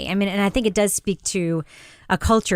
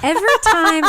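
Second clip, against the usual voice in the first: laughing, high and louder; 10 semitones higher, 5 dB louder than usual. Real, not acted. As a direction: burst out laughing on Every time. Restarts laughing.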